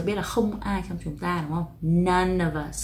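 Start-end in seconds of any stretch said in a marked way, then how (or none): 1.8-2.8 "None" drawn out slowly, "of us" linked after; focus on none. Shown as a demonstration. Teacher stressing the first word for students. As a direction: lean on "None" and glide into "of us". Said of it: "tập trung vào cái phần none này".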